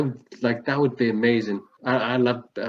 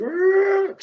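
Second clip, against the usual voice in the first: Wookiee roar. Retold or imitated. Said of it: "Chewbacca played by Dom DeLuise".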